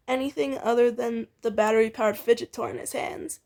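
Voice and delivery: ordinary delivery, nothing marked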